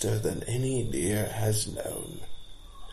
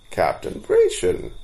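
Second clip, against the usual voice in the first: startled; 12 semitones higher, 4 dB louder than usual. A narrator's reading. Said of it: "said the startled storyteller".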